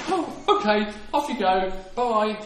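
high, loud, airy send-off; mock breezy agreement. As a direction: imitate an all-too-easygoing ruler waving someone off. Imagined Pharaoh agreeing cheerfully.

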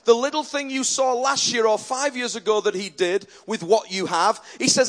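ordinary delivery

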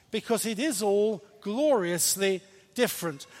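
usual speaking voice

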